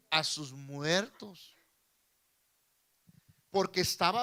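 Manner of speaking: loud, emphatic sermon delivery; full-force preaching. Real, not acted. Preacher quoting Scripture to the brothers, preaching loudly.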